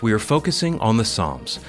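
ordinary talk, nothing marked

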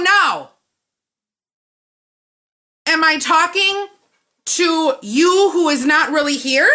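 loud, high sarcastic rant; furious sarcastic outburst at the clerk; indignant rant. Retold or imitated. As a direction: tear into the clerk with mocking disbelief. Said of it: "I left out the F word".

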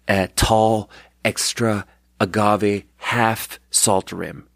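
each item spelled out slowly; patient, slow order recital; slow recited order. Retold or imitated. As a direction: read the order back slowly and carefully.

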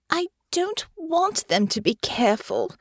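high, whiny complaint; young noblewoman complaining to her lover. A narrator's reading. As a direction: grumble it like a spoiled lady. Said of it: "Honor complained".

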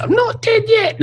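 very high squeaky shout; comic dying man. Retold or imitated. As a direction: cry out in falsetto you're still alive. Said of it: "Yes, I love that".

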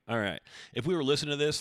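ordinary talk

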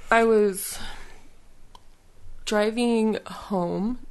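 hiccuping mid sentence; words broken by a hiccup. Real, not acted. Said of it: "Sorry, excuse me, like, the hiccups".

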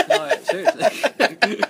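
high squeaky laugh through the words; laughing hard. Real, not acted. Laughing to his co-host about his wife. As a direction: laugh the word out, slowly.

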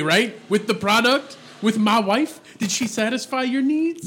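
high, pressing hustler questions; pushy pimp voice. Pretend. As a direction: lean in like a greedy pimp.

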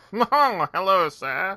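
mock British gent voice; mock posh British. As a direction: fake British politeness. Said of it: "come off like".

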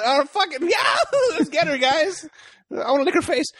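high, laughing mock biker bravado; hammed-up crazed biker. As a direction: play a giggling maniac rushing in. Imitating crazed biker gang.

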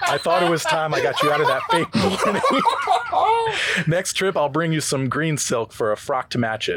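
reads Rhett's lines while breaking up laughing; can't keep a straight face as Rhett; laughing through lines. Acted. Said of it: "you can be Rhett".

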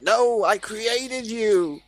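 high, drawn-out plea of a creator; Stan Lee. Acted. Creator dangled off a roof, pleading high. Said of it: "and Stan Lee's like".

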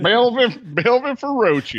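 Feroci with an exaggerated rolled r, high and slow. Shown as a demonstration. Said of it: "Is that a Tennessee, uh, rolling R?".

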